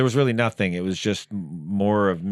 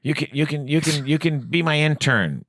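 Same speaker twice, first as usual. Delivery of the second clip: imitating Meisner; 7 semitones higher than usual. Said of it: "Meisner goes".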